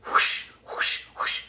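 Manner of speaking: breathy rushing whoosh sounds; whooshing noise. Retold or imitated. Recording ideas fast, mock whooshing rush. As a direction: make the sound of words pouring out.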